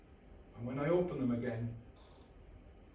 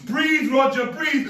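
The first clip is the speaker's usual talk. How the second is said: desperate shouting; 13 semitones higher, 16 dB louder, slower than usual. Acted. Urging himself to breathe.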